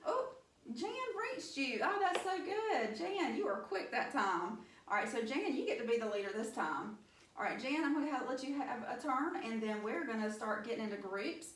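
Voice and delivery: normal talking voice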